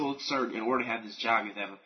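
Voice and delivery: low, soft, polite customer-service voice; smooth it down like a polite phone agent; preppy phone voice. Retold or imitated. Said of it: "my preppy voice on when I worked".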